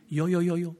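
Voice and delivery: a refined little sob, hammed-up; a prim, genteel fake weep; dainty mock sobbing. Shown as a demonstration. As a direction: sniffle daintily like a polite lady. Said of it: "皆さんはまあ上品な感じですからね。".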